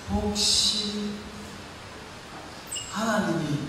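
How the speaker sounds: soft, slow, halting question to God; hushed hesitant prayer. Retold or imitated. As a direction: ask God fearfully, pausing between words. 조심스럽게 묻는 기도 재연. (조용하고 머뭇거리는 기도)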